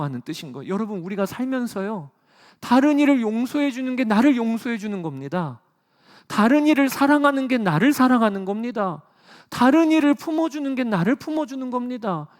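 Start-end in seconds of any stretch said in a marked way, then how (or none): 2.6-12.3 higher, louder repeated proclamation; impassioned preaching. Acted. Pastor exhorts congregation on loving others, loudly. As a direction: press each line harder, preaching.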